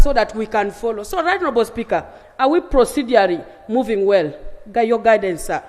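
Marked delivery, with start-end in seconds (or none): none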